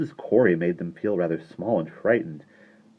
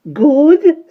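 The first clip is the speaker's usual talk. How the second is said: very high, loud squeaked Good; 14 dB louder than usual. A narrator's reading. Tiny shopkeeper delighted Michael likes the cake, squeaked. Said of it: "squeaked Missus Corey".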